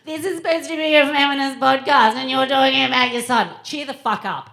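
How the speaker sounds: high, loud mock complaint; complaining listener. Podcast listeners objecting to son talk, mock complaining. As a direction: whine it loudly in a high put-on voice. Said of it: "otherwise people go".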